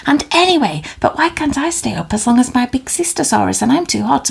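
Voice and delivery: put-on high, fast, whining child pleading to stay up; whiny little dinosaur. Little dinosaur whining at bedtime, high and fast. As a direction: rattle off complaints like a tired toddler.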